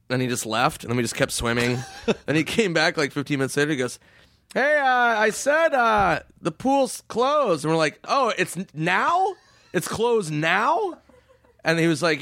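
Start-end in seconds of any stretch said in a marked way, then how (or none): 4.5-7.6 high, slow, hesitant mock guard; put-on guard voice, stretched and pitched up; old security guard. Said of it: "This old security guard's like". 8.0-11.0 high, mock-innocent questions; put-on bewilderment, pitched up; feigned confusion. Band playing dumb with guard.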